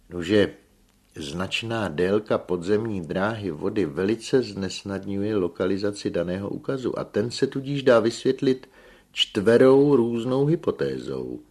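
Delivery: emphatic scientific delivery; engineer resuming his report. A narrator's reading. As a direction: press on formally after the interruption.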